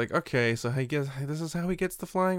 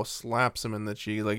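usual speaking voice

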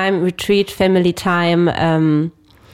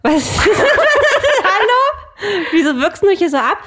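laughing; 12 semitones higher, 3 dB louder, much slower than usual. Real, not acted. Laughs at friend's odd tone.

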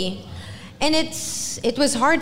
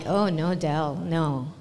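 much softer, lower self-talk; pained realisation; 7 semitones lower, 5 dB quieter, slower than usual. Retold or imitated. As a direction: sink into regret quietly. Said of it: "and I'm like".

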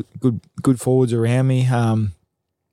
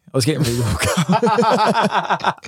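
higher, slow, laughing admission; 7 semitones higher, slower than usual. Real, not acted. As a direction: say it through a laugh. Joke about standing up early.

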